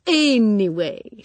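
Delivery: high, slow, breezy anyway; cheerfully. A narrator's reading. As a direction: brush the death aside lightly. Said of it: "she said cheerfully".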